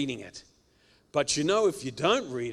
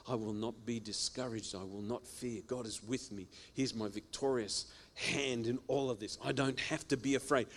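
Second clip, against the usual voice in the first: quiet muttering; 3 semitones lower, 7 dB quieter than usual. Shown as a demonstration. Muttering God's word.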